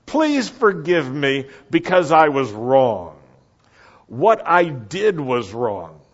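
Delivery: loud, slow, emphatic plea; emphatic apology easing down from a shout; enthusiastic confession. Shown as a demonstration. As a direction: plead it firmly, stretching the words. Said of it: "Can you say it with some enthusiasm?".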